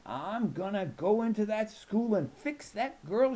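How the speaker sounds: high, slow grandma voice, angry; mad grandma. A narrator's reading. Grandma vowing to fix the girl, slow and mad. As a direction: threaten to fix the bully, slowly. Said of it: "she'd get all mad".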